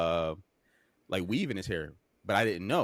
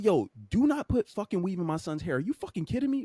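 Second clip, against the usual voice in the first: high, indignant telling-off; retold cussing out; 8 semitones higher than usual. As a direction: re-enact an outraged phone call. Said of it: "I'm fucking cussing her".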